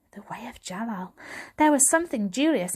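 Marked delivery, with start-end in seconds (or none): 0.1-1.1 soft unvoiced whisper. A narrator's reading. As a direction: breathe the name reverently. Narrator hushes reverently.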